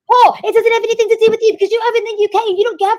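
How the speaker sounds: high-pitched indignant tirade; heated, high-voiced telling-off; angry outburst. Real, not acted. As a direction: rail at him without letting him in. Heated quarrel, cutting Paul off.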